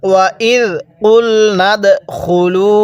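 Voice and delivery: drawn out tajweed chant; slow recitation. Shown as a demonstration. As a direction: stretch every vowel, slow and measured. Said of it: "হ্যাঁ, কিভাবে শিখব?".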